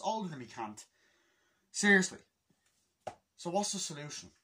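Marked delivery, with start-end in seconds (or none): none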